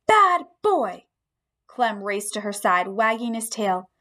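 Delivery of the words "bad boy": higher, louder scold at the dog; girl scolding her dog, high and loud; firm scold. Acted. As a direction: tell the dog off firmly. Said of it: "she shouted in a firm voice".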